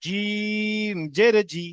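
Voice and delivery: জিম held long and slow; drawn-out recitation. Shown as a demonstration. Teacher draws out the letter name for the class. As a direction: stretch the জিম before the syllable. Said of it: "জিম প্রথমে টান দিয়ে দেবো".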